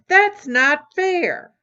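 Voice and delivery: a brother shouting at his sister over the unfair choice. Acted. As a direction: shout it out in protest. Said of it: "shouted Tommy".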